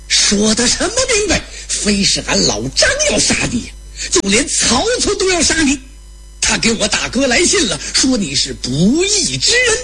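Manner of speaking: high, heated retort; acted warrior's indignant outburst; still angry. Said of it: "张飞这气儿没消".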